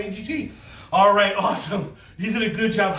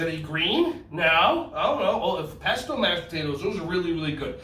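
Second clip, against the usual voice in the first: ordinary talk, nothing marked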